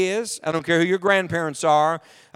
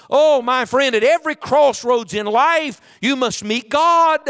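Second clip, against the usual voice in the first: louder, rising preacher's appeal, slowing at the end; fervent preaching; 4 semitones higher, 4 dB louder than usual. Acted. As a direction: plead with the congregation at full force.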